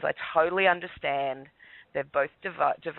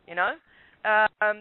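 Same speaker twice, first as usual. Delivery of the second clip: normal talking voice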